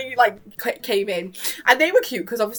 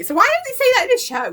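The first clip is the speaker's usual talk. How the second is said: exasperated rant; 6 dB louder, faster than usual. Real, not acted. Exasperated with the kids' show.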